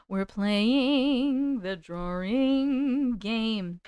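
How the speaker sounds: very slow stretched announcer voice; slow sing-song game announcement; slow announcing. Real, not acted. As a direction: stretch every word like a host. Drawn-out announcement repeated.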